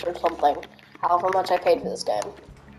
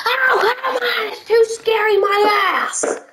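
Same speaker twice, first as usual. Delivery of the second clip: angry outburst; 13 semitones higher than usual. Real, not acted. Gamer yelling at the screen, furious high shout. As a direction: yell in real frustration, pitched way up. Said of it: "Sorry, I got upset".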